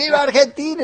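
high-pitched mock yell of an overwrought fan; imitated fan yell. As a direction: imitate a crazed supporter yelling, pitch shooting up. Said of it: "algún loco que no puede más con su nervio y grita".

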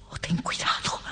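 hushed, secretive caution; whispered warning. Acted. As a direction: whisper the warning to your husband. Warning husband, first take hushed. (advertencia susurrada)

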